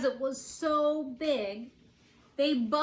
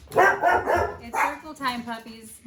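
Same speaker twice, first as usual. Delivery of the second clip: loud, higher, drawn out; raised voice to noisy puppies; calling to the dogs; 4 semitones higher, 8 dB louder than usual. Real, not acted. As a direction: call over the barking, slowly. Hushing barking dogs mid-reading.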